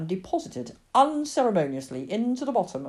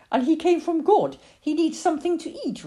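put-on high, earnest little girl; insistent child; 12 semitones higher, 6 dB louder than usual. Lily tells her mother God sent the cat, high and insistent. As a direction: argue the stray's case with conviction.

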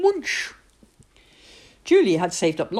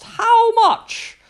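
put-on eager girls; 8 dB louder than usual. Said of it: "came running and asked".

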